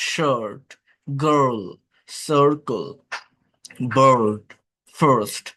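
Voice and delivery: each word alone, falling, the আর sound in বার্ড, গার্ল clear; teacher word drill. Shown as a demonstration. Teacher drilling the ir sound in words for students. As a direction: model each word slowly, stressing আর. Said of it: "আই না হয়ে আ বা আ হয়".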